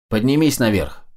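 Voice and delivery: usual speaking voice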